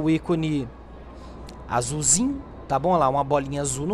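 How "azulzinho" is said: ordinary talk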